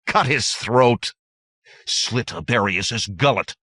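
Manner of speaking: loud mob cry for blood; angry shouts. A narrator's reading. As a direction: shout for his death from the crowd. Angry bandits call for blood.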